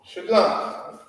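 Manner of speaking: mock curt shalom. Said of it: "They give kickback".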